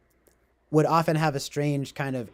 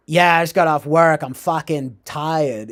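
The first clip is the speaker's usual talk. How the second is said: imitating Boston accent; 2 semitones higher, 9 dB louder, slower than usual. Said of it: "a Boston accent".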